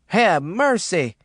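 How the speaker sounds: loud, slow, drawn-out exclamation; screaming. A narrator's reading. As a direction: scream out for mercy. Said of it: "Yellow Jack screamed".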